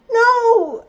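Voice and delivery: loud, high-pitched no; emphatic refusal. Retold or imitated. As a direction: shut the request down at full voice. Refusing his second request.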